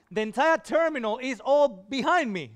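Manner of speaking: usual speaking voice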